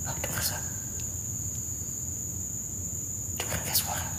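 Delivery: tense whisper; hushed, frightened whisper; nervous night vlog whisper. Real, not acted. Whispering in a haunted place.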